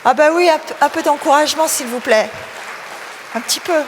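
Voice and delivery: rallying the audience. Real, not acted. Host asks audience to encourage shy young readers, rallying them. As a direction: coax some applause out of the crowd.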